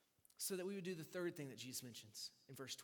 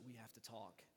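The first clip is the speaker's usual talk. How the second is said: hushed serious; 6 semitones lower, 8 dB quieter than usual. Retold or imitated. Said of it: "she leans over and she's like".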